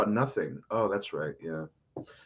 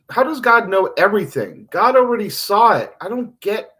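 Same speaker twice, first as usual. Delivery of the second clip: higher, louder exasperated questioning; railing at God; 5 semitones higher, 7 dB louder than usual. Acted. Host questions God's knowledge, railing in exasperation. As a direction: challenge God with raised voice. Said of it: "Theo, stop railing against God".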